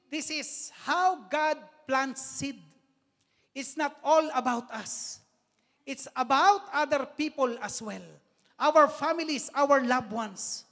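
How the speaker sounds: slow, rising preacher's appeal to the congregation; preaching. Acted. Priest urging his congregation, slow and rising. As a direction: lift the voice and stretch the words for the flock.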